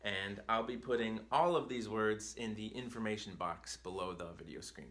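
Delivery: ordinary talk, nothing marked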